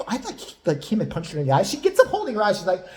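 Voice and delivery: ordinary talk, nothing marked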